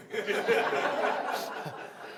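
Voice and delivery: quiet, breathy chuckle to himself; amused quiet chuckle; soft laugh. Real, not acted. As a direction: laugh softly at your own scheme. Laughing at own plan.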